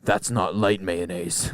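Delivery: put-on Southern accent. Fussy customer to sandwich artist, slow Southern accent. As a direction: drag it out like a Southerner. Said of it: "why did he become a Southerner".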